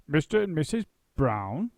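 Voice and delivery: bewildered echo; dazed. A narrator's reading. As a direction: repeat it in a daze. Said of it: "in a dazed voice".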